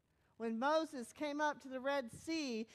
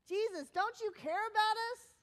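higher, louder, upset appeal; upset plea; 9 semitones higher, 7 dB louder than usual. Acted. Disciples waking Jesus in the storm, upset plea. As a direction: demand it of him, voice raised.

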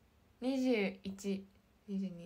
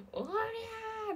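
mock battle cry; 12 semitones higher, much faster than usual. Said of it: "誰かの叫び声が聞こえた".

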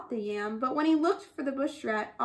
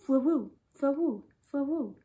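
slurping sounds; slower than usual. A narrator's reading. Eating honey sound.

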